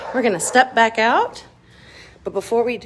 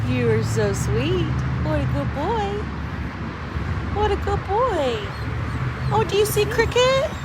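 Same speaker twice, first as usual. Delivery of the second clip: talking to a dog; 8 semitones higher, 3 dB quieter than usual. Real, not acted. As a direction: coo tenderly at the dog. Petting the dog.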